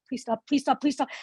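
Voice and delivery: mortified pleading. Retold or imitated. Daughter begging her gushing parents to stop, mortified. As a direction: beg them to stop, mock desperate.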